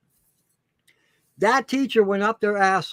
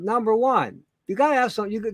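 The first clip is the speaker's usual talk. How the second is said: ordinary talk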